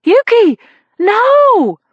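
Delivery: high, loud warning scream. A narrator's reading. Reporter screaming a warning as Yuki steps out, loud and high. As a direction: scream her name in alarm. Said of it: "Candy Stimpson screamed".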